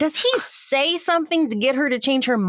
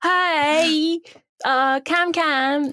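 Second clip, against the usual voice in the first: slow, higher mock British accent; exaggerated British accent; 4 semitones higher, much slower than usual. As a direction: stretch it out in a put-on British accent. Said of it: "stop with the accent".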